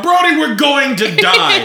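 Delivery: high, loud, slow panic; panicked. Retold or imitated. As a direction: wail in mock terror. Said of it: "you're like".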